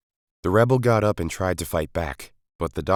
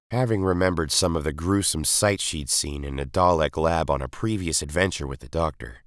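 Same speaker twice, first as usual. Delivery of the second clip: ordinary delivery, nothing marked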